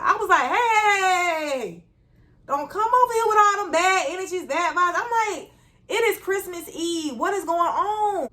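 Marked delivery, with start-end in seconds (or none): 0.5-1.8 high, drawn-out mock yell; exasperated interruption. Cuts off coworker's complaining at work. 2.5-4.9 high-pitched, forceful retold protest; exasperated plea. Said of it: "I ain't said it in the rude way". 5.9-8.3 high, slow, drawn-out disbelief; exasperated protest. Retold or imitated. Protests coworker's ranting on Christmas Eve.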